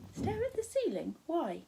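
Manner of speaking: high astonished query; girl astonished by Charles's advice, rising high; surprised. Acted. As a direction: leap up high in disbelief. Sophie surprised.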